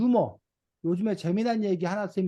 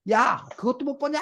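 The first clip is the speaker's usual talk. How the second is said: angry dad's outburst; 6 semitones higher than usual. Acted. Dad yelling at a soccer player on TV, mock angry. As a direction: play at snapping in exasperation. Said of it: "화가 나서 소리를 뻐럭 질렀어".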